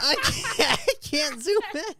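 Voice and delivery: high, laughing frustration; frustrated squeal. Real, not acted. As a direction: squeak it out, exasperated.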